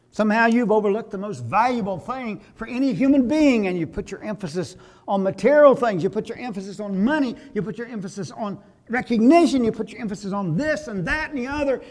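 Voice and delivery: preaching at full force. Acted. Pastor rebuking congregation over material things, full-force preaching. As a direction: hammer each emphasis line like a revival preacher.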